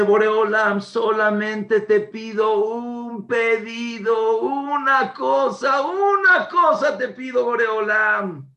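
slow, high, loud plea to God; loud pleading. Retold or imitated. Father crying out his one request to God. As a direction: beg for one thing with full voice.

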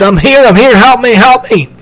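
high-pitched, repeated panicky calls; screamed plea for help. A narrator's reading. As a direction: keep crying out to be rescued. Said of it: "he screamed".

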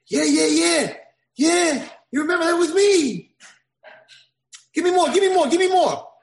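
high, loud exultant cries; excited shouting. Acted. Old man exulting as prince remembers, high shouts. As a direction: burst out gleeful and loud.

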